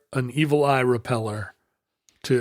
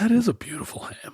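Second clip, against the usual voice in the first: breathy mock whisper, a little higher; 5 semitones higher than usual. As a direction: whisper it like a secret joke. Joking aside in whisper.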